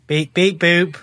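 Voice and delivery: beeping droid; toy robot voice. Acted. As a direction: answer like a little toy robot. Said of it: "my droid counterpart".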